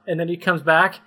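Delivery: usual speaking voice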